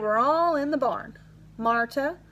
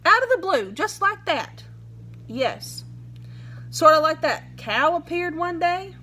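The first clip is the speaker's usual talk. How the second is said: lightly voiced sheriff, higher and pressing; a hint of a hard-nosed lawman grilling the boy; pushy sheriff; 6 semitones higher than usual. Sheriff pressing the silent boy with questions, higher pitched.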